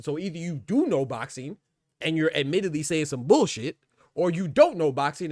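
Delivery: heated ranting. Real, not acted. Streamer scolding boxing fans in chat, loud and exasperated. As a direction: hammer the point home, fed up. Peak of angry boxing rant.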